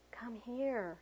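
slow, hushed, gentle invitation; soft whisper. A narrator's reading. Reading a poem about tone to teachers, soft whisper. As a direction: coax a child over very softly. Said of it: "I softly whispered".

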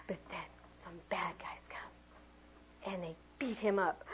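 slow hushed whisper; ominous whisper. Acted. Teacher telling preschoolers the bad guys come, hushed whisper. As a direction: whisper it slowly, building suspense.

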